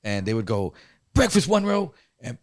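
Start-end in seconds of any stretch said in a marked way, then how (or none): 1.1-1.9 higher announcing guard call. Retold or imitated. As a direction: call out the row number like a guard.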